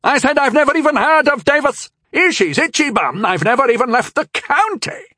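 put-on very high, loud old man's voice; panicked old knight, high and loud; insisting old knight. A narrator's reading. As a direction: protest it urgently.

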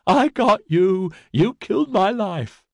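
high, drawn-out demented sing-song; unhinged, crowing chant; peculiar sing-song. A narrator's reading. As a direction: lilt it far up high, slow and gloating. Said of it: "in a peculiar sing-song voice".

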